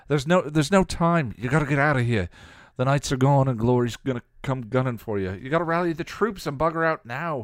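high, loud and insistent; heated warning pushing them to get out; angry, urgent retort. A narrator's reading. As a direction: fire back with urgent anger. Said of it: "he countered angrily".

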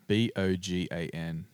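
ordinary talk, nothing marked

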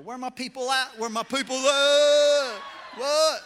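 loud rising call to the crowd. Real, not acted. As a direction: shout it out to the congregation. Preacher calling out to congregation.

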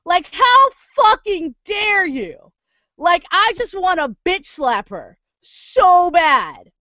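high-pitched, slow, seething disbelief; real outrage. Real, not acted. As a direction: spit out every word in fury. Rant continues after laugh.

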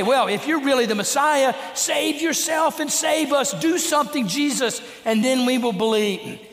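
scornful ridicule. Retold or imitated. Preacher voicing the mocking thief's scornful challenge to Jesus. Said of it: "there was scorn, ridicule in his voice".

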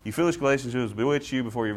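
skim it without feeling; deliberately wrong, hurried reading; rushed flat read. Shown as a demonstration. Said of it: "very, no".

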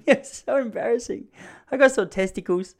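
high, helpless mock giggling; laughing. Embarrassed boy confessing to the nurse, laughing helplessly. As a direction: squeak it out through giggles. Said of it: "she saw me and my mate laughing".

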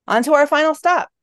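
high, loud showman's call; tour guide announcing. A narrator's reading. Guide moving the tour group along, announcing. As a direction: announce it brightly to the whole group.

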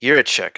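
Jiricek repeated as YEER-uh-check; Jiricek with "y" first and "check" last; corrected pronunciation. Real, not acted. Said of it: "I've heard it pronounced Jiricek".

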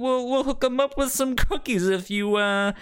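shady mobster making an offer; mafia gangster. Retold or imitated. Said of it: "you're like".

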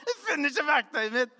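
high, loud desperate yell; shouting. Retold or imitated. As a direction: yell it out in panic like in the dream. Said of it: "så ropte jeg i drømmen". (høyt, desperat rop)

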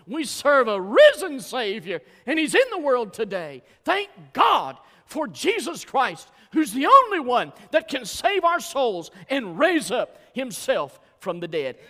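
loud, high preaching proclamation; exultant pulpit cry; preaching at full force. Acted. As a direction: lift it up and shout the good news. Joyful climax on risen Savior.

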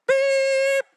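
high, loud bleep; a sharp censor beep; loud high-pitched bleep. Pretend. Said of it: "It's all censored".

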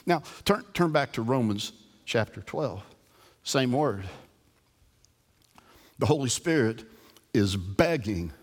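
ordinary talk, nothing marked

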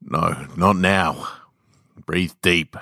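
usual speaking voice

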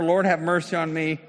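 high, loud cry for mercy; shouting. Retold or imitated. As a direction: call out loudly to Jesus. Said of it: "so they shouted louder".

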